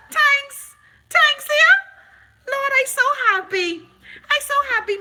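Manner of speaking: very high, loud, hammed-up joy; mock ecstatic thanks. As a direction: squeal the thanks like a fake overjoyed believer.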